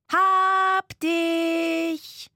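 high, loud, long-held catching cry; called out. A narrator's reading. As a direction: call it out in triumph, stretching each word. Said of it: "rief eine Stimme". (laut und langgezogen gerufen)

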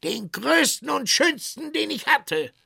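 high, loud demand; high-pitched tinker's outcry; calling out. Acted. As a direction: cry out indignantly, high and loud. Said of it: "rief der Kesselschmied".

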